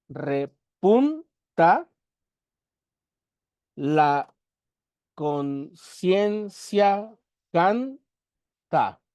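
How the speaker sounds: "repunta, la conciencia canta" broken into slow separate beats; syllable by syllable. Shown as a demonstration. Teacher counts the verse's syllables for students, slow and separated. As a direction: count out each syllable with a long pause after it. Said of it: "Once sílabas".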